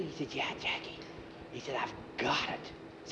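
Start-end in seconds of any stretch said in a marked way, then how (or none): 0.3-1.0 whisper. Acted. Whispered reply to boy. 1.8-2.7 whisper. Acted. Whispered secret at bedtime.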